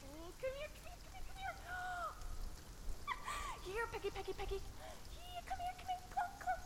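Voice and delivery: high sing-song coaxing to a dog; coaxing a pet. Acted. Girl coaxing Peggy the dog closer. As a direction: softly call the dog over.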